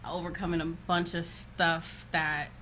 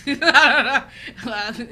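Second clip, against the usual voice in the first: ordinary delivery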